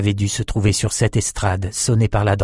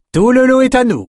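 much higher and louder declaration; commanding claim; 6 dB louder than usual. A narrator's reading. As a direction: claim it all with authority. Maxime claims the whole lot.

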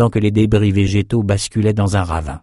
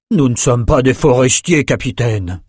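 loud enough for all; 4 semitones higher than usual. Acted. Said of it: "assez fort pour que tout le monde entende".

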